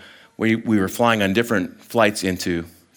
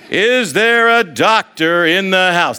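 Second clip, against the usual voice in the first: louder, higher and slow, with a laugh; drawn-out mock yell; 7 semitones higher, 5 dB louder, slower than usual. Friend hamming it up across the airport, slow booming yell. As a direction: stretch it out and boom it like a silly announcement. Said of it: "That's exactly how he's doing it".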